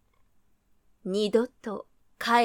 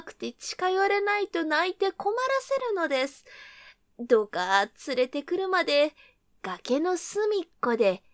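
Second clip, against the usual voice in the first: flattering; 5 semitones higher, 4 dB louder than usual. Acted. Rabbit flatters the wolf.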